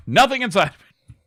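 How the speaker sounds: high, loud exasperated cry; mad outcry. Real, not acted. Player finding the smashed toolbox empty, high mad outcry. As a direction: burst out in frustrated disbelief.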